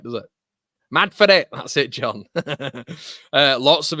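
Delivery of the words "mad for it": loud, high Manc catchphrase; mock Mancunian. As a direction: belt it out as a caricature Mancunian. Mock Mancunian catchphrase after accent demo.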